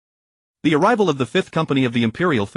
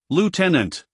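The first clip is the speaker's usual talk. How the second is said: flat robotic voice, slightly higher; a hint of a machine speaking; emotionless mechanical voice; 4 semitones higher, slower than usual. A narrator's reading. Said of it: "emotionless mechanical voice".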